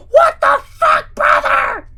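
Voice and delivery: horrified shriek. Acted. Drew shrieking as the crust consumes the town. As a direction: shriek in total horror. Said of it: "Drew shrieked in horror".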